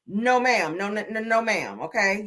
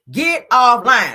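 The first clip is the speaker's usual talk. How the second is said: louder and very slow, drawn out; slow loud command; 3 semitones higher, 11 dB louder, much slower than usual. Retold or imitated. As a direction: bark it out slowly as a final order. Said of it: "I said".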